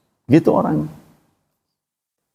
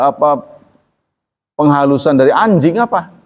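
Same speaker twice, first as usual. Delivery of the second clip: ordinary talk, nothing marked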